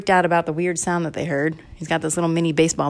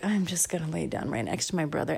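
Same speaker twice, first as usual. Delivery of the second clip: sleepy sister; 12 dB quieter than usual. Acted. Sister lies down by her brother, sleepy murmur. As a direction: murmur it as if drifting off. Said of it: "is so sleepy".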